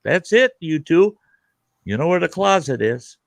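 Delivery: high, loud fatherly order, fading off; mock stern father; stern dad. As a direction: imitate the girlfriend's dad ending a fight. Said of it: "her dad would say".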